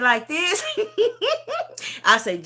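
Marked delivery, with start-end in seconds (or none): 0.0-2.0 high mock question dissolving into laughter; giggling, pitched-up imitation; laughing. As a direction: mimic her disbelief, then crack up. Imitates daughter's reaction, cracking up.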